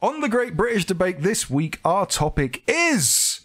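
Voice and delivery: slow hyped announcer build; wrestling promo. Pretend. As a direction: draw it out like a ring announcer. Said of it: "That's my inner Brady".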